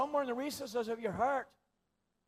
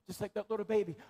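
ordinary talk, nothing marked

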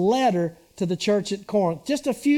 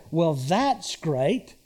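drip with sarcasm, slowly; sarcastic; 5 semitones lower, much slower than usual. Shown as a demonstration. Pastor showing the church a sarcastic tone. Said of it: "Same words ... the tone is different".

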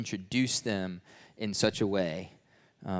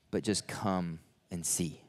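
low, slow, soft reassurance; gentle invitation; 6 semitones lower, 6 dB quieter, slower than usual. Retold or imitated. God answering questions about the future, gently reassuring. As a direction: lower and slow down, inviting softly.

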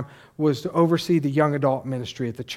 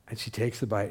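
ordinary delivery

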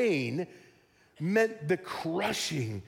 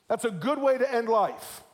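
normal talking voice

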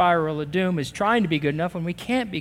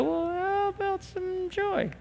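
squeaky hopeful ask; 11 semitones higher, 9 dB quieter, slower than usual. Pretend. Listener voiced asking God for more.

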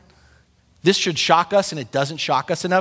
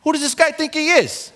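much higher, brash East Coast indignation; East Coast style; 9 semitones higher than usual. Retold or imitated. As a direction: scoff like a New Yorker. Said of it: "East Coast style".

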